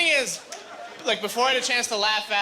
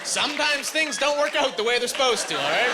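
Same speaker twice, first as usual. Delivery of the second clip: mock shout; 5 semitones higher than usual. Snapping back at heckler.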